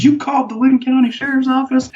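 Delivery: very high-pitched incredulous outburst; mock outrage. Van owner learns the sheriff was called, mock outraged disbelief. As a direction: imitate an irate man shooting up in pitch.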